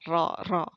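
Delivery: ro rolled two or three times; short trilled r. Shown as a demonstration. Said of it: "Paling getar-getar dua, tiga kali".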